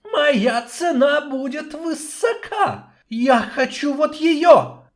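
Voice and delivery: high, slow greedy hiss; hissing snake voice. Acted. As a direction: gloat like a greedy serpent. Snake king names his price.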